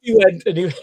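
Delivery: can't hold back the laugh; real laughter breaking into the telling. Real, not acted. Laughs at end of anecdote.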